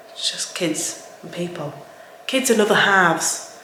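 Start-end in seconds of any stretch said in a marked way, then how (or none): 0.1-1.7 whispered aside. Real, not acted. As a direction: drop to a breathy confiding whisper. Aside about messy houses.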